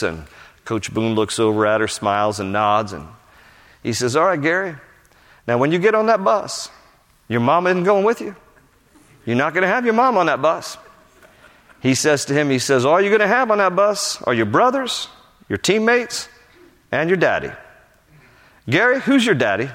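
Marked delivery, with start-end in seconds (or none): 4.2-10.8 lightly acted coach, higher pitch; coach's voice. Coach to his player before training camp, lightly voiced firm coach. 12.8-19.7 higher-pitched acted coach voice; coach's challenge. Coach confronting his star player, acted challenging voice.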